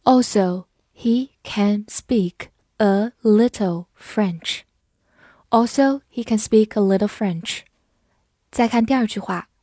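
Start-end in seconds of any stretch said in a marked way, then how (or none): 0.0-4.6 slow, word by word; slow reading. Shown as a demonstration. Teacher modelling a sentence about French, read slowly. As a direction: leave a gap after each word. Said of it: "我们慢读一遍".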